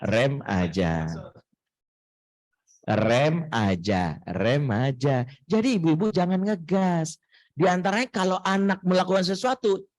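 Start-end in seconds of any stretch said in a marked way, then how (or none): 0.0-5.3 slow, low, drawn-out "rem aja"; slow repeated advice. Shown as a demonstration. Preacher telling mothers to brake, slow and low. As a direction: stretch out "rem aja" like braking gently. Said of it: "ortu dilarang ngegas".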